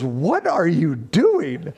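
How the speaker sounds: slow, incredulous mock questioning; baffled wife. Wife upstairs questions husband's late-night viewing, imitated bafflement. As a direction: draw the question out in puzzled disbelief.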